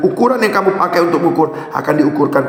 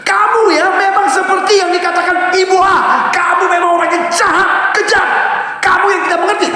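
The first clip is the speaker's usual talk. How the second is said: accusing shout; 12 semitones higher than usual. Shown as a demonstration. Wife accusing husband of being cruel, shouted high. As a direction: throw the blame at him. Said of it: "menghakimi ya".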